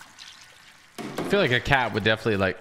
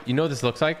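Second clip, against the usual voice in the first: ordinary talk, nothing marked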